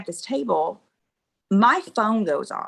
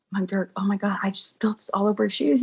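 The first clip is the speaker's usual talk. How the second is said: fast, breathless whisper of horror; panicked whisper; faster than usual. Retold or imitated. Spilled drink on his shoes, whispering to herself in panic. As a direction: hiss it under your breath, mortified.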